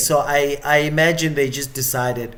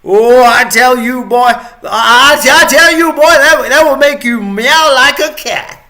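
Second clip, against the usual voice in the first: hammed-up Southern accent, rising to a shout; American Southern accent; 6 semitones higher, 7 dB louder than usual. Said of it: "American Southern accent".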